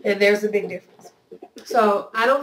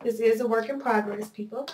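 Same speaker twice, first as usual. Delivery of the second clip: ordinary delivery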